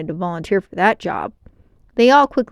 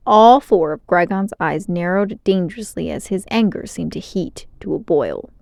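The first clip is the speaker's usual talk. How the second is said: usual speaking voice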